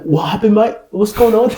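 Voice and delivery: high-pitched put-on Australian; Australian accent. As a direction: bluff in a mock Australian accent. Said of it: "I turned Australian".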